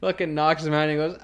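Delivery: ordinary talk, nothing marked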